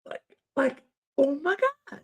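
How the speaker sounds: put-on very high girly chirp with whoops; high hammed-up valley girl. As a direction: cheer it like a giddy teen.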